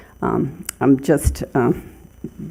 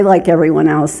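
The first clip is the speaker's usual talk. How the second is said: ordinary talk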